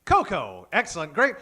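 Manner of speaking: usual speaking voice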